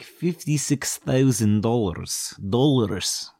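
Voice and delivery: slow, hammed-up Romanian accent; mock Romanian accent. Telling the traveller the fuel price, slow mock Romanian accent. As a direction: draw it out in a fake Romanian accent. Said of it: "oh, this accent".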